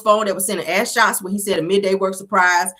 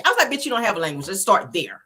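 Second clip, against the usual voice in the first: ordinary talk, nothing marked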